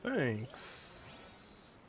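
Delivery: ordinary delivery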